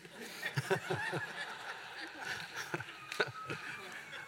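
audience bursts out laughing; real congregation laughter; crowd laughter. Real, not acted. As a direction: room roars with laughter.

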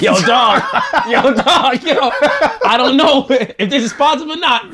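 high, laughing 'yo dog' through giggles; laughing disbelief. Retold or imitated. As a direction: crack up while pleading with him. Retelling doubts to winking surgeon.

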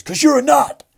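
high, loud military bark in your face; imitating a fierce military roar; David Goggins shout. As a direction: yell it like a drill sergeant in someone's face. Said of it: "Proper, like, military, in your face".

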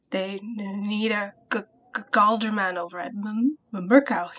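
stuttering through chattering teeth; shivering, teeth chattering. Acted. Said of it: "he said through chattering teeth".

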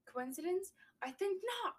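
high-pitched punch on NOT; stress on NOT. Acted. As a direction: imitate the movie line, hitting NOT hard at the end. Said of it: "emphasizes the "not".

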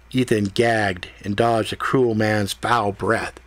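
usual speaking voice

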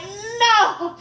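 high, wailing no, half crying; fake-cry the no like a scared kid; mock wail. Retelling a scared answer to mama, mock wail. Acted refusal, retold to mama.

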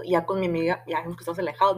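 ordinary talk

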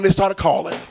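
ordinary talk, nothing marked

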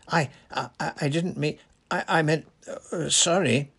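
high, stammering backtrack; flustered apology. A narrator's reading. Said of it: "he finished in a hushed voice".

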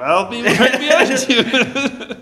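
slow, high, loud line through laughter; laughing. Real, not acted. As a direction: crack up while teasing. Joking about following to bathroom.

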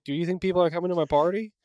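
high, quick little robot voice; Claptrap. Retold or imitated. Imitating a lonely robot waiting at his birthday party, high and quick. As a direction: pitch it up, hurried and hopeful. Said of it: "Claptrap's like".

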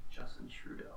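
whispered echo of a name; whispered aside. Real, not acted. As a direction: say it under your breath, whispered. Co-host echoes the name aside.

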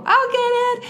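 put-on high, loud kid's shout; excited yell. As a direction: yell it to win the race. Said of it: "we'd all scream and yell".

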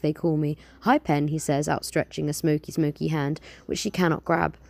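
lively storytelling read-aloud; story narration. Acted. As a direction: tell it like a bedtime tale. Reads her own story aloud.

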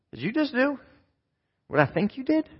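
high, soft disbelieving question; the king can't believe what he heard, up high; shocked king. Retold or imitated. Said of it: "and said".

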